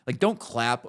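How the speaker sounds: normal talking voice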